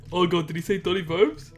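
ordinary delivery

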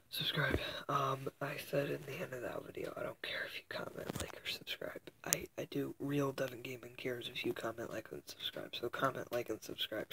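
whispered outro; breathy whispered sign-off; whispering. Real, not acted.

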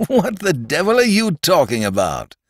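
laughing, high-pitched disbelief; loud laugh. A narrator's reading. Doctor scoffing at the mystical priest, laughing loudly. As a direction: burst out laughing through the question. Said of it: "said the doctor with a loud laugh".

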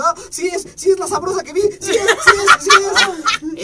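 high, loud, laughing stammer; hammed-up nervous glee; nervous excitement. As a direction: stutter it out giddy and flustered. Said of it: "me puse nervioso".

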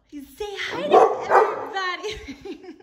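high, loud dog talk with laughter; excited high-pitched pet voice; coaxing a dog. Real, not acted. Coaxing her dog to speak.

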